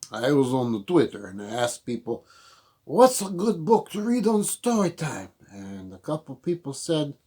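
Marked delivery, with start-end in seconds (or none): 2.8-5.3 quoting question. Retold or imitated. Quoting own tweet.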